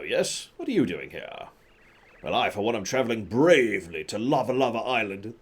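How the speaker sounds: pompous British explorer with swooping pitch; hammed-up British adventurer, stretching grand words; faux British accent. Colorado introducing himself to Mario, grandly, in faux British accent. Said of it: "I wish I could do a faux British accent".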